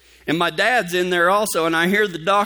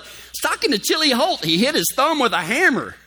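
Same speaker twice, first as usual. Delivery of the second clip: retold dad; 9 semitones higher than usual. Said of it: "He's like".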